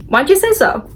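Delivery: a quick high-pitched mimic; high mock quote. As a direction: voice jumps up high, mock-innocent. Claudine retold as surprised.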